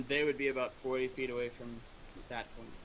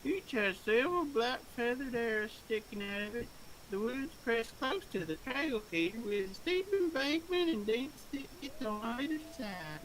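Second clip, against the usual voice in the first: game master narration; 11 semitones higher than usual. Acted. DM scene setting.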